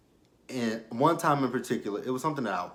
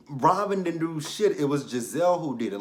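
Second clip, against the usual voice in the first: exasperated; 6 semitones higher, 4 dB louder than usual. Real, not acted. Defending Robin, exasperated.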